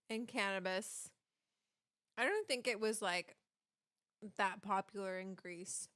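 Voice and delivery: ordinary delivery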